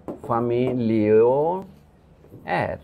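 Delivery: ফ্যামিলিয়নিয়ার said very slowly and softly, drawn out; coined word demo. Shown as a demonstration. Lecturer revealing the new word again, slow and soft. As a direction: let ফ্যামিলিয়নিয়ার unfold slowly, quietly. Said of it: "তখন এই শব্দটা তৈরি হলো".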